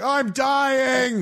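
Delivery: high, shouted mock agony; hammed-up dying yell; mock dying henchman. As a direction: scream it like a burning extra. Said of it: "people are like".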